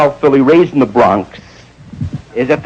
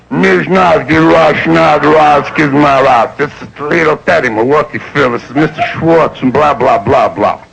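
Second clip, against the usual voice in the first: gibberish talk. Retold or imitated. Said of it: "they sound like tape backwards".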